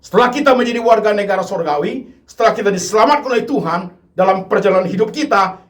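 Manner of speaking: high, loud, insistent altar call; full-force preaching. Acted. The preacher urges unbelieving listeners to accept Jesus, loud and insistent. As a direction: drive it home, urging them to decide.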